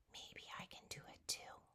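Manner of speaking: barely-there hopeful whisper. Pretend. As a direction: breathe the words, unsure.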